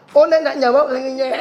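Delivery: phone owner answering in the same nasal speech; nasal speech imitation. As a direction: answer with blocked-nose speech. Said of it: "jawabnya gimana".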